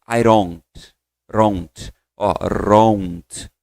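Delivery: "don't" said "ront" with a tapped r sound; flapped d. Shown as a demonstration. Teacher exaggerates the native flap sound, forced. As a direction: turn the d of "don't" into a quick r tap. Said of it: "eu forcei aqui pra você ouvir o som do R".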